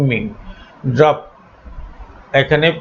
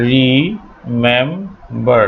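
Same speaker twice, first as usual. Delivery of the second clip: slow syllable demo; much slower than usual. Shown as a demonstration. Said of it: "শেষে stress পড়ে না".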